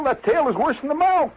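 high-pitched astonished warning; alarmed warning. Acted. Club member warns guest about the alligator's tail, alarmed. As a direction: warn him as if he should have known.

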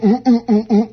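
loud, rhythmic "ooh" grunts; pumping grunts. Shown as a demonstration. Showing how you pump an Irish mail, loud rhythmic grunts. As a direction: grunt it out on each push, high and loud. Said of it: "you go".

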